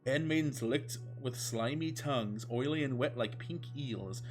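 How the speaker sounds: ordinary delivery, nothing marked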